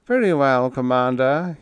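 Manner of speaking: high, loud little pipe; lifted, piping little man. A narrator's reading. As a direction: pipe up cherubically. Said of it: "he piped".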